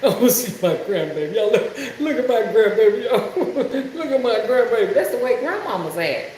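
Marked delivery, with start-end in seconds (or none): 0.0-4.9 mock doting grandmother. Teasing Mister Willie by imitating him, doting grandma coo. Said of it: "Well, let me see my".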